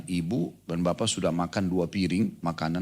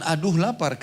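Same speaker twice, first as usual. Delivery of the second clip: high, whiny "Aduh lapar" with a groan on aduh; mock complaint; 8 semitones higher than usual. Shown as a demonstration. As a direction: complain about the hunger, a bit hammed-up. (keluhan manja yang dibuat-buat)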